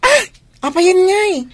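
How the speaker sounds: startled. Acted. Father startled by his daughter's sickness, sharp question. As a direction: jump at the news.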